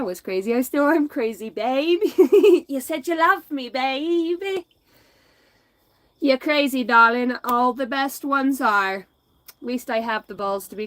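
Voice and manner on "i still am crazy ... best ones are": 1 laughing flirty banter. Real, not acted.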